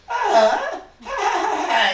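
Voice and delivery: high squeal of shock; a sharp high yelp at the new hand; shocked cry. Acted. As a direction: yelp it out in surprise. Doctor shocked at robber's hand.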